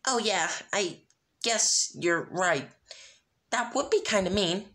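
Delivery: downcast Pinkie. Acted. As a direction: let the bubbly voice sag. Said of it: "said in a downcast voice".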